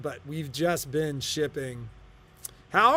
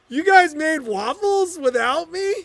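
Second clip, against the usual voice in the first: put-on high, loud whiny kid protest; indignant son; 11 semitones higher, 7 dB louder than usual. Son to parents about the waffles, high indignant whine. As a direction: play the betrayed kid who missed out.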